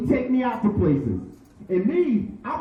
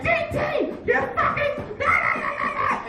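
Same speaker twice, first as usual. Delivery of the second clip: mock yell at friend; 3 dB quieter than usual. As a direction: yell at your buddy half-laughing. Yelling at GT in car.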